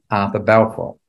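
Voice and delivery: normal talking voice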